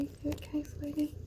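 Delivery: high, soft whispered pet talk; cooing to the cat. Real, not acted. Soothing her pet cat.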